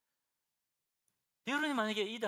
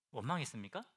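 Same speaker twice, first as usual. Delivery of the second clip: usual speaking voice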